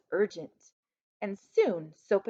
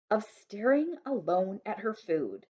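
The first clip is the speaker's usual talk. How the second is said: plain reading voice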